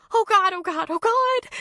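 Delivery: high anguished cry. A narrator's reading. Woman clutching her hurt puppy, anguished high cry. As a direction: cry out in panic over the limp dog. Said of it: "she cried".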